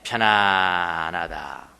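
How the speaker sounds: low, long-held middle vowel; drawn-out comfort. Shown as a demonstration. Preacher explains ingrained habit feels comfortable, drawn out low. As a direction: sink into the word and hold it.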